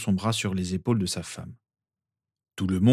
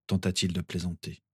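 ordinary delivery

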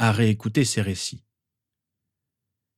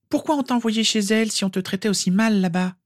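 high-pitched shocked question; appalled outcry; 12 semitones higher than usual. A narrator's reading. Girl asks why Harry was sent there, appalled. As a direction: protest in a high girlish voice. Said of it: "s'écria Rose".

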